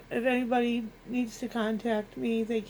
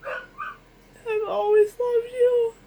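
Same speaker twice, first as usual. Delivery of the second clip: crying; 10 semitones higher, 4 dB louder than usual. Real, not acted. Tribute to late husband, weeping.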